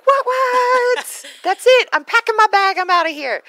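put-on high, loud squeaky teen protest; indignant kid voice. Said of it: "Jensen's like".

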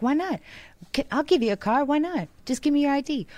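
high, breezy salesman voice; mock car dealer. Mocking dealers who would hand over a car, high and breezy. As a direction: play a too-easy dealer, high and carefree. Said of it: "They'd be like".

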